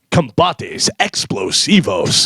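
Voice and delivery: slow, drawn-out Spanish fight promo read; boxing commercial announcer. Retold or imitated. Voice guy ending a Mexican fighters commercial, slow announcer impression. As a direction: imitate a dramatic Spanish-language boxing announcer. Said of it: "And at the end, he goes".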